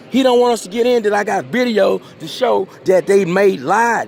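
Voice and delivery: man accusing the judge to the court, indignant. Real, not acted. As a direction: hammer the grievance home. Angry complaint about the judge.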